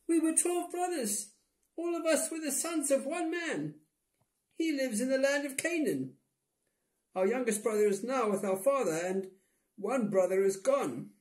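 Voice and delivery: very high, squeaky brothers voice; brothers' voice. A narrator's reading. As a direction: pitch way up for the brothers.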